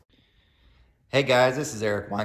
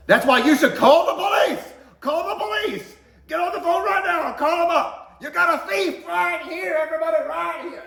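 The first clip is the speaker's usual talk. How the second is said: much higher, screaming angry mom; furious shout; 8 dB louder than usual. Retold or imitated. Mom demanding the manager call police, furious shout. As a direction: yell it for the whole store. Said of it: "When she's angry, everybody knows".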